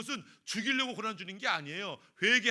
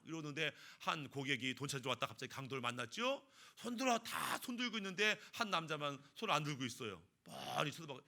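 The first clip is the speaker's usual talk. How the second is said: ordinary delivery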